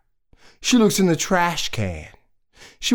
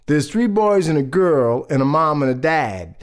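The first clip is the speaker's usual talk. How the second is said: plain reading voice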